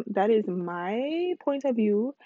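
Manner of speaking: ordinary talk, nothing marked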